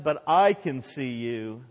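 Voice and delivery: slow, steady fatherly answer, stress on "I"; dad replying. Retold or imitated. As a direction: reassure the boy, leaning on "I". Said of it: "the dad said and replied".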